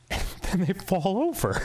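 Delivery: high, laughing Farley voice; Chris Farley impression. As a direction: giggle through the punchline. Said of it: "And Chris Farley's like".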